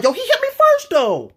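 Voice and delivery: put-on very high, loud childish protest; whiny kid protest. Boy caught punching, very high whiny protest. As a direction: plead innocence like a caught kid. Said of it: "I'm like".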